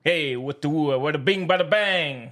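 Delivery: mock New York wise-guy accent; hammed-up New York patter. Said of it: "New York accent".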